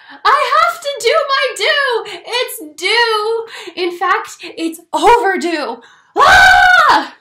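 very high, loud crying shout; desperate wail ending in a scream; frantic shout. Acted. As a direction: scream it, bursting. Lou desperate, can't hold it.

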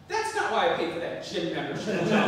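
loud, indignant scold; scolding mother. Acted. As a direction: scold him loudly like an outraged mother.

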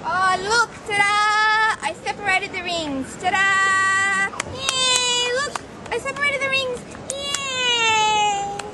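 triumphant ta-da. Acted. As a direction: present the trick with a flourish. Showing off the trick.

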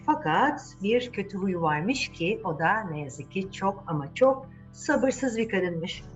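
ordinary delivery, nothing marked

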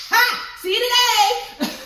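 high laughing burst; giggling at her own slip. Real, not acted. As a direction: laugh through the line.

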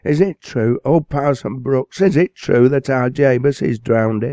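high, loud pleading mother; mother crying out in anguish to the parson. Acted. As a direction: beg to hear it isn't so. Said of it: "she cried in anguish".

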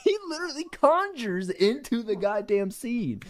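high yelp breaking into laughter; squeal, laugh, then protest in amazement; laughing disbelief. Real, not acted.

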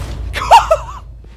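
very high, loud laughing scream; real high squeal of joy; ecstatic shriek. Real, not acted. Overjoyed after the reveal.

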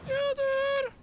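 very high falsetto call; drawn-out squeaky cry; squeaky cartoon voice. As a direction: call out like a tiny cartoon creature. Same squeaky voice calls.

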